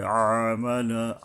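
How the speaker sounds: "আমালা" slowly, with a tight throat on the first "আ"; squeezed-throat ayn. Shown as a demonstration. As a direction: start "আমালা" from mid-throat, pressed and drawn out. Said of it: "গলা চেপে আদায় করতে হবে". ("আমালা"-র আইন গলা চেপে টেনে)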